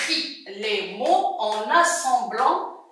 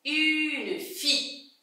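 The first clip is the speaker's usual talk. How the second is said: slow, softer listening model; stretched-out word; 2 semitones higher, 6 dB quieter, slower than usual. Shown as a demonstration. Teacher repeats for pupils to hear F, stretched-out word. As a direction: hold "Une" long before "fille".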